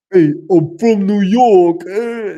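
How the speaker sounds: cartoonish New York shout; New York accent. Shown as a demonstration. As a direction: belt out a hammed-up New Yorker accent. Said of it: "can I do a Long Island accent".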